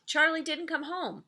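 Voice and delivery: ordinary delivery, nothing marked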